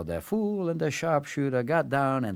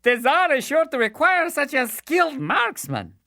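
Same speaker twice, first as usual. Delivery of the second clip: high, loud simpleton; the fool; 4 dB louder than usual. Acted. Fool recruiting the marksman, high and loud. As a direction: boast cheerfully about the Tsar. Fool's voice.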